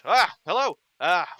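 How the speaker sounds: big burly kebab seller greeting a customer; burly vendor. Acted. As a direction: boom a cheery hello at a customer. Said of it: "And he goes".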